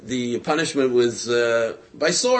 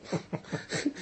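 breathy laugh; 3 semitones higher, 13 dB quieter than usual. Real, not acted. Storyteller laughs at punchline.

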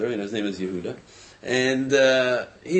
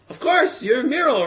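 high, loud burst of recognition; sudden recognition; 13 semitones higher, 7 dB louder than usual. Retold or imitated. Man recognizes the saleslady as Miro, surprised and loud. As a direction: act out remembering someone, pitch leaping up.

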